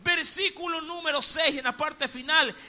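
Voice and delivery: loud, high sermon delivery; full-force preaching. Acted. Preacher driving the point home to the congregation, loud. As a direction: preach it to the rafters.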